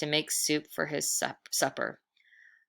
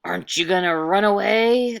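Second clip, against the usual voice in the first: louder, eerie ghost voice calling down; strange ghostly call; 3 semitones higher, 7 dB louder than usual. Acted. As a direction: call down the chimney like a spirit. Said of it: "the strange voice called down the chimney".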